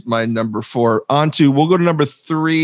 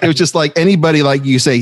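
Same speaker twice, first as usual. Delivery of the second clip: ordinary delivery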